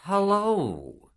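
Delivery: the devil cheerily greeting the soul; cheery call. A narrator's reading. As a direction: call out friendly and inviting. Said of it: "he cheerily called".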